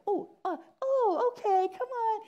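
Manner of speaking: high, flustered backpedalling; mock sheepish, suddenly overeager welcome; squeaky embarrassed crowd member; humiliated. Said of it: "You would feel really humiliated".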